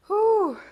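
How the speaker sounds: high, loud puff of breath after effort; loud whew. Real, not acted. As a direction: blow it out hard and high like you're spent. Exhale after hard set.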